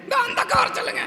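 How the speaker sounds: very high puppet-character voice; டிங்கன். Pretend. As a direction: call out to the crowd in a squeaky high voice. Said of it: "டிங்கன் வந்து ஒரு கதை". (மிக உயர்ந்த பொம்மைக் குரலில்)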